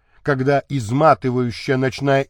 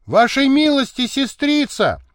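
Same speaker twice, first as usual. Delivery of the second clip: lightly voiced footman calling high; high-pitched servant's announcement; servant's hurried report; 10 semitones higher than usual. A narrator's reading. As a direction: announce it high, just run in. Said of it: "доложил, прибежав, лакей Юшка".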